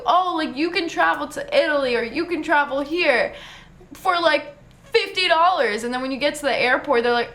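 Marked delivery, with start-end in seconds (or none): 0.0-5.8 mock sales pitch. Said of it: "They tell you".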